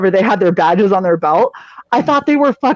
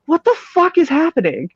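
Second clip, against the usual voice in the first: retold shock; 8 semitones higher than usual. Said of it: "And I was like".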